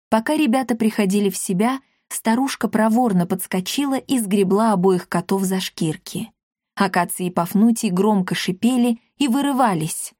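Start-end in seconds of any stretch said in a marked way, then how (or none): none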